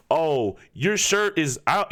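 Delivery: ordinary delivery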